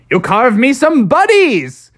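high, loud, slow eager squeal; a pumpkin shouting its wish with glee; excited pumpkin. Acted. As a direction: burst out with a happy idea. Pumpkin voiced, eager request.

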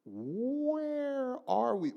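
high, slow, baffled "where are we"; bewildered traveler. Retold or imitated. Preacher imitating frightened fellow travelers, high and slow. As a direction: stretch it out, lost and amazed. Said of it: "people are like".

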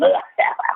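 imitated scurrying witch's cackle; hammed-up witch laugh. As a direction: cackle like a crazed one-eyed witch. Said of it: "scurrying around the castle with her one wide eye going".

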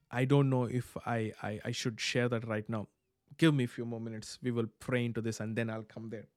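quiet aside. Real, not acted. Private aside to self.

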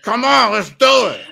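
insistent mock pusher; pushy grabbing voice. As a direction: shove it at them eagerly. It's like.

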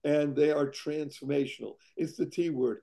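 ordinary talk, nothing marked

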